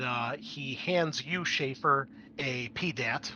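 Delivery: normal talking voice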